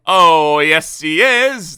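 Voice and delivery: loud, slow chanted reply; crowd shouting. Acted. The crowd shouting back at Miss Trouble, loud and slow. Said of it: "they all shouted".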